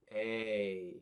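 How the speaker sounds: long ay like the letter name. Shown as a demonstration. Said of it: "That's the long A sound".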